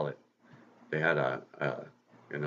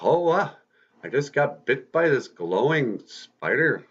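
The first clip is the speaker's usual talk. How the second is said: higher, louder startled storybook voice; startled exclamation; 7 semitones higher, 4 dB louder than usual. Acted. Peter Parker just bitten by a spider, startled. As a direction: act out a mild startled realisation, drawn out.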